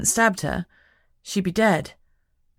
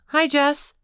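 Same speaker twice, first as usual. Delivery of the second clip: higher, guarded greeting; Lucy answering; 9 semitones higher, 4 dB louder than usual. A narrator's reading. Lucy answering Jess's call, higher guarded hello. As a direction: greet her friend carefully, higher. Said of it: "she didn't sound her usual excited self".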